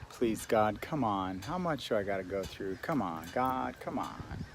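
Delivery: soft, low pleading to God; hushed, exasperated plea; mock prayer. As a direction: pray it quietly, worn out and half-joking. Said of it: "Listing agent's prayer".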